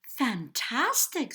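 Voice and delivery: loud, high, drawn-out cheer; delighted storybook princess; excited praise. A narrator's reading. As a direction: cheer the dragon on, stretching the word.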